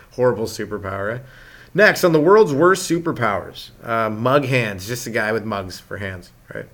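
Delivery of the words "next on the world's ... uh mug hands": mock promo. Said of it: "mock TV promo".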